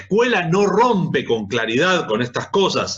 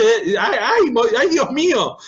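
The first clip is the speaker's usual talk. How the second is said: high, exasperated ay Dios mío; aghast; 7 semitones higher than usual. Real, not acted. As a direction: groan in real dismay at yourself. Dismay at his own upbringing. (exclamación de espanto, aguda)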